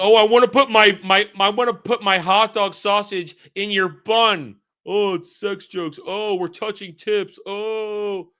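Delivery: high mock-dopey jeering; mocking imitation. Mocking the movie's crude jokes.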